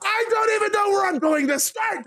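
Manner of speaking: high, screamed rage into the mic; screaming. Acted. Seth screams into the microphone at Damien, furious. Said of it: "starts screaming to the microphone".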